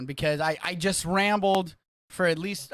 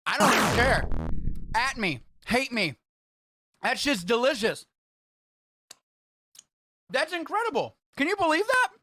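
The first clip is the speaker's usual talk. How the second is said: higher, louder excited raving; excited rave; 7 semitones higher, 5 dB louder than usual. Real, not acted. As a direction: hype it up like it's the best thing ever. Raving about the taste combo.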